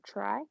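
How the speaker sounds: "try" lifted high for wry emphasis; stress on "try". Shown as a demonstration. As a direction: lean playfully on "try". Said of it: "I would put emphasis on the word".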